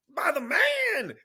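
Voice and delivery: high, slow shout of disbelief; shouting the punchline in disbelief; incredulous shout. Real, not acted. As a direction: hit it loud, stunned at the absurdity. Outraged at absurd cult story.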